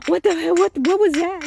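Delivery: high, loud frightened questions; scared camper asking what that noise was; retold panic. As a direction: blurt it out in alarm. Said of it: "and I'm like".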